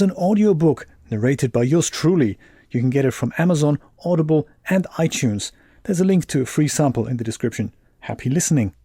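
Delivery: loud, polished announcer plug; promotional ad read. Acted. Plugging an audiobook to stream viewers, loud ad read. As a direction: project it like an advertisement.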